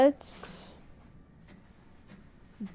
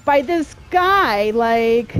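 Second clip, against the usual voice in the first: loud, higher-pitched panic; frantic yelling; 4 semitones higher, 8 dB louder than usual. Real, not acted. Player being chased and bullied by this guy, frantic yelling. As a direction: cry out while fleeing an attacker.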